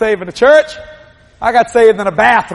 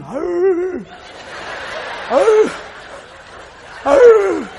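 mock dog howl; 9 semitones higher, much slower than usual. Preacher imitating a dog howling at the moon.

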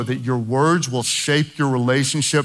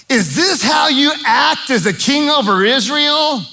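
high, scornful taunting wife; Jezebel mocking; 10 semitones higher, 3 dB louder than usual. Jezebel scorning her husband Ahab, high mocking taunt. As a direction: sneer it down at the sulking king. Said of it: "Did she really say it like that?".

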